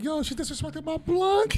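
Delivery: high, drawn-out indignant exclamation; mock outrage. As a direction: cry it out in mock offence. Acting out outrage over a blunt.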